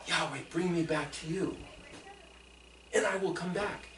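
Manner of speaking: soft, low, humble prayer; a hushed prayer to be brought back; hushed repentant plea. Acted. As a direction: beg quietly to come home.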